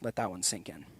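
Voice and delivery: normal talking voice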